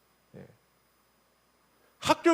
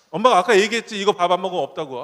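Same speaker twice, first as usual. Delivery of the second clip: louder scolding mom warning; stern mother; 9 dB louder than usual. Retold or imitated. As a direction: lay down the rule like a firm mother. (단호하게 꾸짖는 엄마)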